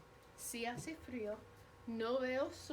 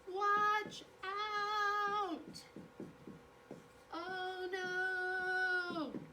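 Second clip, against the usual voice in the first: alarmed yell; 8 semitones higher, much slower than usual. Retold or imitated. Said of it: "what you yell".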